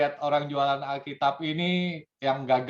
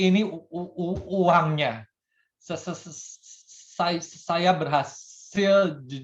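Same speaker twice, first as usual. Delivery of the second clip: higher, stammering every syllable; hammed-up stutter; 4 semitones higher than usual. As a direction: force each word out through the stutter. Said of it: "yang gagap ini". (gagap berlebihan dengan nada tinggi)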